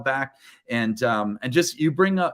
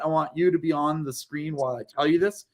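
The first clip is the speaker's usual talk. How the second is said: ordinary talk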